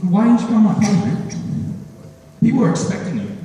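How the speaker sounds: mildly angry imitation of a let-down pal; angry friend. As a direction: confront him with a touch of accusation. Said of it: "He actually looked cold and angry".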